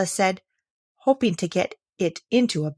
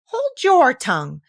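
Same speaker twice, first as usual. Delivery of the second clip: higher, louder, slow scolding; sharp rebuke; 7 semitones higher, 6 dB louder, slower than usual. A narrator's reading. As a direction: cut the violet off sharply. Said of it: "cried the tiger lily".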